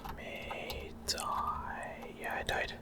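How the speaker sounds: ordinary talk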